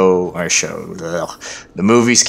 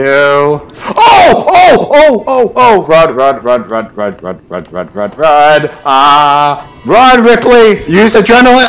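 frantic high panicked yells; yelling while running away; 5 semitones higher, 9 dB louder than usual. Real, not acted. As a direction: scream it while fleeing for life. Yelling while fleeing alien.

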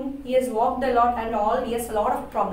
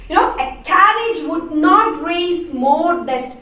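slow, loud, sneering jibe; mock scorn; 5 semitones higher, 7 dB louder, slower than usual. [name] retold mocking Gonzalo's dusty walk, louder and slower. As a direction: drag it out to belittle him. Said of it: "she says".

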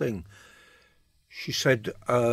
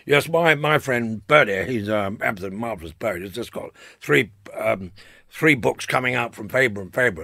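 put-on posh voice, pushed louder; 6 dB louder than usual. Mate boasting about his friend to a poet, very posh voice. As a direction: imitate a very posh man praising a friend. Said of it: "he spoke with a very posh voice".

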